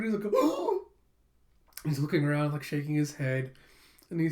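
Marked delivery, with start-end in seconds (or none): 0.3-0.9 high, startled mock gasp; sudden high-pitched cartoon gasp; freaked-out gasp. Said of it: "he just freaks out".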